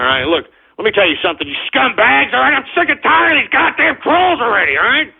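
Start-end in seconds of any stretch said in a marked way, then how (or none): none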